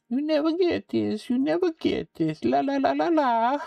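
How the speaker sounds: high sing-song taunt. Retold or imitated. Teasing the player in a high sing-song taunt. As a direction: tease them in a high singsong.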